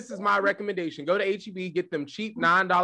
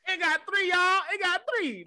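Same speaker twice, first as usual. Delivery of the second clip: excited squeal; 10 semitones higher, 5 dB quieter than usual. Pretend. Acting out finding a third ball.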